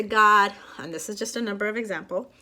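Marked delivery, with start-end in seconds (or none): 0.0-0.5 firm, loud call to God; assertive. Shown as a demonstration. Showing viewers how to ask God, assertive. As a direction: address God with assertive force. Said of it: "I say it like that".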